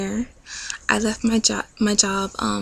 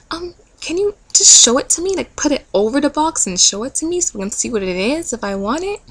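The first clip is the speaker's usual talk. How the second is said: put-on much higher, childlike pleading voice; reluctant whiny self; 5 semitones higher, 6 dB louder than usual. Her reluctant self begging God to bring the gift, whiny high voice. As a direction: wheedle like a kid who won't leave.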